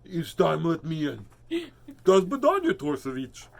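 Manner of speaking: mock Russian villain-cartoon accent. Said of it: "that stereotypical cartoon Russian".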